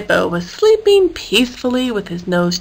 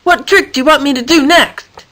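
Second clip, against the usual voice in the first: lightly voiced eager David, calling higher; 5 semitones higher, 3 dB louder than usual. David calling to his elephant pal, bright call. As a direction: call out the question cheerfully. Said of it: "David called out to him".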